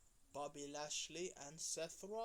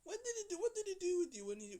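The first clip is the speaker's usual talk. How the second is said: much higher, louder prodding question; pushy question; 14 semitones higher, 8 dB louder, faster than usual. Retold or imitated. Cameraman pressing the Miz about Dexter, high excited questioning. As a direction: pitch it way up like a nosy interviewer. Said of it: "And he said".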